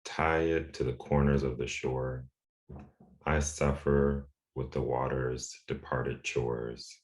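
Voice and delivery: measured poem recital with pauses between lines; poetry reading. Real, not acted. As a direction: read the poem deliberately, letting each image stand. Poet reads their poem aloud.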